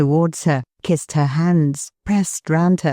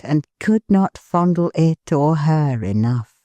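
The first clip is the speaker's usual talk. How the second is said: ordinary delivery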